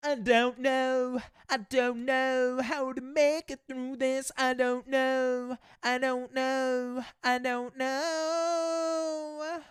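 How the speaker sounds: lilting mock British accent, drawn out; slow wobbly British singsong. British accent attempt singing.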